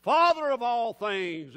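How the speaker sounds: low, measured, pious prayer; mock formal prayer. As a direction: intone it solemnly, swelling on the last phrase. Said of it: "we don't need to start a prayer".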